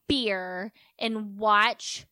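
mock sulky. Acted sulker wanting to be alone.

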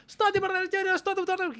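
high, shrill mock scolding in fake Russian; mock Russian yelling. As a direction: imitate an angry cleaning lady yelling. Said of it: "התחילה לצעוק עליי ברוסית".

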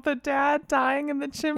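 ordinary talk

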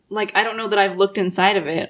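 ordinary delivery

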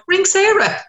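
very high-pitched mimicked cry; exaggerated high voice, passing the buck; mock call-out. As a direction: imitate them in a high singsong call. Mimics professionals passing her the problem.

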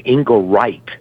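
"Ingerite" with a stressed long I, ending like "right". Shown as a demonstration. Guest correcting host on a priest's surname, "Ingerite" stressed on "-rite". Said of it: "the emphasis is on the I".

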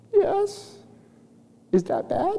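put-on tiny, high, soft child voice; small squeak, quietly unsure; Chloe. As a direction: imitate a sheepish little girl. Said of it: "Chloe begin to cry".

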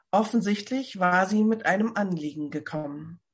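plain reading voice

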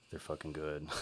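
soft, impressed undertone; hushed awe. Retold or imitated. Retelling his first listen to the band, hushed awe. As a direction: say it quietly, as a retold discovery. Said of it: "I'm just like".

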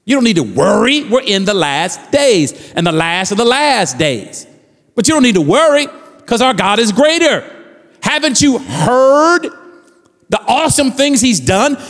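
rising scripture proclamation, building up; fervent pulpit reading; preaching. Acted. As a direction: proclaim Isaiah to the church.

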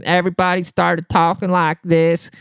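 high, dopey mocking drone; mimicking the customer. Coworkers mimicking the customer among themselves, stupid mocking voice. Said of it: "we started mimicking him".

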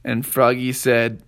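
ordinary delivery

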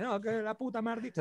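high, soft, slow mock cursing; niño vuelto loco. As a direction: imitate a little kid cursing wildly. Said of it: "estaba ahí vuelto loco". (niño malhablado, voz aguda)

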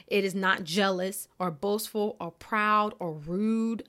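ordinary delivery

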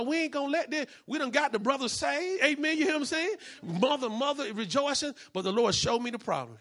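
loud, rising preacher's testimony; full-force preaching. Acted. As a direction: drive the story home to the congregation. Preaching continues.

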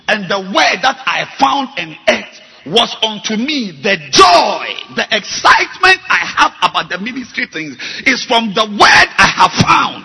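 loud, climbing pulpit rebuke; preaching at full force. Acted. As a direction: hammer the congregation with each question. Pastor preaches about the word.

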